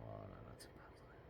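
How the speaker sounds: whispered grumble at her own mistake; muttered whisper. Real, not acted. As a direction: mutter it to yourself, exasperated.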